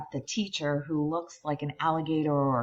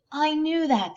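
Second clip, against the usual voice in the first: put-on smug kid; 12 semitones higher, 4 dB louder than usual. Derek boasts.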